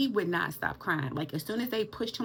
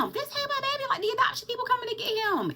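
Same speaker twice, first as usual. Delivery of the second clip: put-on much higher, louder indignant teen self; mock defiant reply; 4 dB louder than usual. To the older nurse, refusing the baby in a high mocking voice.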